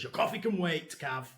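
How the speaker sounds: loud and high, snapping at a daydreaming student; a teacher catching someone zoning out, loud and sharp; stern lecturer call-out. Retold or imitated. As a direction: bark it at the back row after slamming the ruler. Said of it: "slams his ruler on the desk and says".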